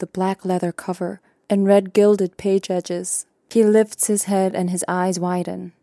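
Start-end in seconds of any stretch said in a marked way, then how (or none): none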